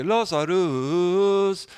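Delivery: slow, high, drawn-out churchy Lazarus; mock pious voice. Shown as a demonstration. Preacher mocking religious smarm, slow holy voice. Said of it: "Det var inte liksom att han fick en annat röstläge".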